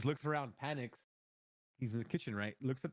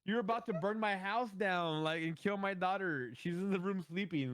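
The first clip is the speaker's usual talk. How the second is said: exasperated scolding; 6 semitones higher, slower than usual. Retold or imitated. Scolding brother, daughter asleep.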